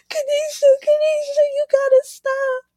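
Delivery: high squealing pleading through helpless laughter; laughing hysterically. Retold or imitated. Telling her friend to stop making her laugh, high squealing laughter. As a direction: beg your friend to stop while cracking up. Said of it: "I'm just laughing so hard. And I'm like".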